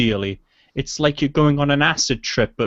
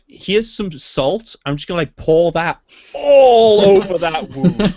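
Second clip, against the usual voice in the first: sarcastic; 7 semitones higher, 5 dB louder than usual. Retold or imitated. Sarcastic mock offering of salt.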